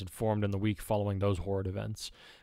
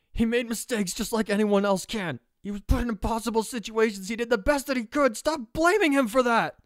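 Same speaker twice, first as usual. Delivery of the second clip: high, loud, frantic outburst; screaming at herself; 14 semitones higher, 6 dB louder than usual. Acted. Girl defending the boy to herself, screamed outburst. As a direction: scream it, pushing higher to the last words. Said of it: "she screamed internally".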